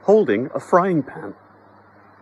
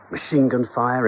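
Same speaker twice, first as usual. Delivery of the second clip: plain reading voice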